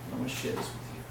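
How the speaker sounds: soft, half-voiced old man sharing a secret; quiet retold voice. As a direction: hush it like a confidence. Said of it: "He says".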